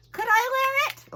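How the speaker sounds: high, loud eager puppet; a puppet asking to borrow the mask. Acted. Puppet asks again.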